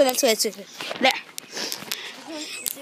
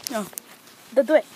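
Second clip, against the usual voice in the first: lower, softer begging; quiet plea; 11 semitones lower, 7 dB quieter, faster than usual. Real, not acted. Kid begging his friend not to slam him, quiet plea. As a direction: plead under your breath. Pleading before being slammed.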